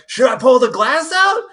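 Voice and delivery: high, loud panicked question; panicked kid. Retold or imitated. Kid asking brother about the glass, high panicked. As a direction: blurt it out like a scared kid. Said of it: "and I'm like".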